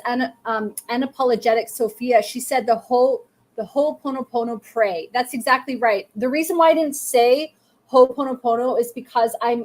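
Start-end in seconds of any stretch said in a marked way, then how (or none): none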